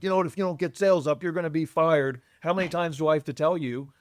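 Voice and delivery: hammed-up angry boss, rushing; mock yelling boss. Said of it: "yelling at your colleagues like".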